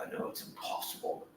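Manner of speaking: "I know it's impossible" breathed without voice; whispered. Real, not acted. Praying alone in the cell, whispered repeat. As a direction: drop to a bare whisper.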